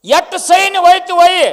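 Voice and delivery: commanding king. Acted. King's order repeated forcefully.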